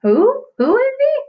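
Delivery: loud high puzzled question; hammed-up confusion; mock puzzled. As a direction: act baffled for the kids. Teacher pretends not to know a character.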